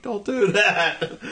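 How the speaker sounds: slow, firm "don't do that"; flat warning. Retold or imitated. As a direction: warn him off slowly. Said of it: "and I was like".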